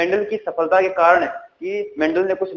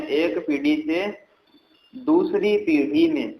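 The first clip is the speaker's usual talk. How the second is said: slow dictation; 4 dB quieter than usual. Shown as a demonstration. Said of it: "क्या बोला मैंने".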